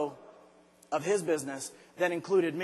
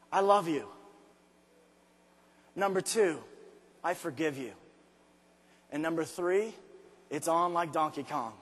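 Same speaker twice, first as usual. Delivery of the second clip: softer, gentle reassurance; tender self-forgiveness; 4 semitones lower, 5 dB quieter than usual. Retold or imitated. Man forgiving himself in the mirror, soft and tender. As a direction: soothe yourself after the scolding.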